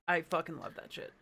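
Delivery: usual speaking voice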